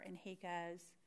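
ordinary talk, nothing marked

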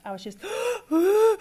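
high, loud gasping sob; a real-sounding choked gasp. Retold or imitated. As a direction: catch your breath mid-sob. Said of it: "crying like a baby that could not catch its breath".

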